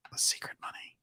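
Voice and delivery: a conspiratorial whisper on the title. Acted. Host whispers the secret episode title to viewers, hushed.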